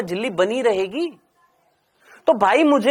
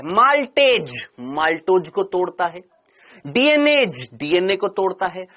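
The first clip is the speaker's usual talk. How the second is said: ordinary talk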